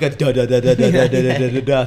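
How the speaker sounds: rapid, even run of engine chugs; imitated engine noise. Imitating radio mechanics answering caller, motor noise. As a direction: patter it out like a running motor. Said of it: "your car should be doing".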